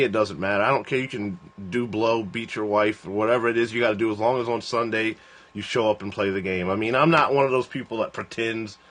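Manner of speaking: ordinary talk, nothing marked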